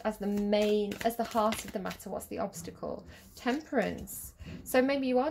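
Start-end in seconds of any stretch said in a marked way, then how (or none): 2.1-3.1 breathy whisper, much softer, voice dropped out. Shown as a demonstration. Reader asking the cards under her breath, whispering. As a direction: murmur the question to yourself. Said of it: "repeats the question as aside".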